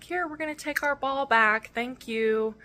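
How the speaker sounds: a hint of a firm but kind father; imitating the dad. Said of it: "he's like".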